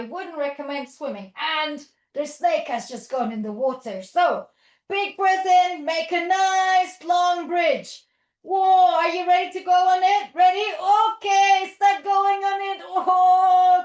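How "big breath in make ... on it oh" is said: loud, raised, stretched-out cheering on; playful excitement. Pretend. Teacher sending children onto the bridge, loud playful excitement. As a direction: hype up the pretend bridge crossing. Building and boarding pretend bridge.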